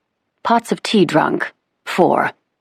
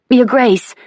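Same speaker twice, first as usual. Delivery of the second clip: a high, louder call for attention; urgent maid; 9 semitones higher, 4 dB louder than usual. A narrator's reading. Maid trying to catch the Duchess's attention, high and urgent. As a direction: interrupt politely but urgently. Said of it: "the poor maid was trying to gain attention".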